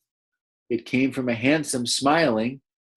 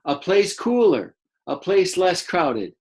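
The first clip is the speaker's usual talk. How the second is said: higher lively reading; extra energy; 6 semitones higher than usual. Shown as a demonstration. Teacher rereading the dassies' words with energy. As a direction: give the words a lift of energy. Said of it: "giving their voice a little extra energy".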